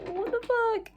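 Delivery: very high, stunned what the fuck; overwhelmed by kindness; amazed disbelief. Retold or imitated. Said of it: "And I was just like".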